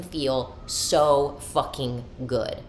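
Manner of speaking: drawn-out, deliberate words; slow, savored emphasis. Real, not acted. Woman on her man's love of surprises, slowly savoring. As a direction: stretch out each word of "so fucking good". Repeats line, first drawn out.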